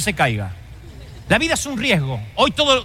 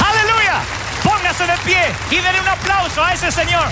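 preaching at full force; 5 semitones higher than usual. Real, not acted. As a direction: lift the crowd to their feet. Rousing applause call.

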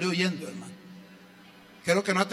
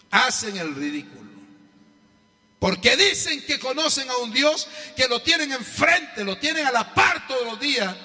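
preaching; 5 semitones higher than usual. Acted. Preaching at full force.

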